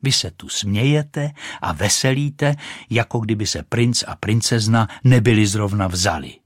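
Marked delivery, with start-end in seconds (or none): none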